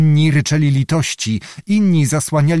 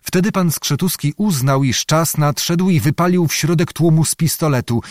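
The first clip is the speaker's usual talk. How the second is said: usual speaking voice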